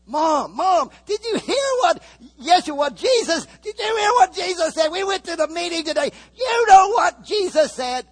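high, loud, crying shout to Mom; frantic family shout. Acted. Sibling shouting to Mom about what Jesus said, crying out. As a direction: yell it like a panicked sibling running home. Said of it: "for they were saying".

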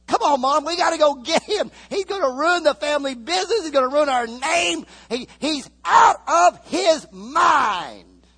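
high, loud pleading yell, slowing at the end; urgent shout. Acted. Brother urging Mom to go get Jesus, loud and high. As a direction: drag Mom out the door, shouting. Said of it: "for they were saying".

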